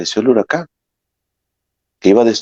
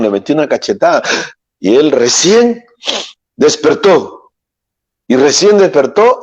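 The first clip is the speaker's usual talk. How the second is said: ordinary delivery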